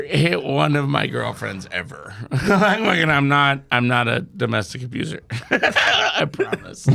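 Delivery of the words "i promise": high laughing promise. Real, not acted. Swearing he's harmless while laughing.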